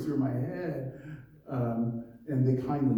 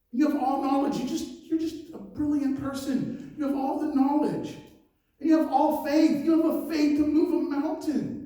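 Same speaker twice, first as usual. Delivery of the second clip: rising, louder, higher preaching climax; preaching at full force; 8 semitones higher, 3 dB louder than usual. Acted. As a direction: build it up like a fiery preacher. Preaching builds to a peak.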